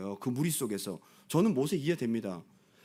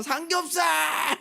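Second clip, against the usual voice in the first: mock wailing cry; 11 semitones higher, 3 dB louder, much slower than usual. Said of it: "전부 울기 시작하고".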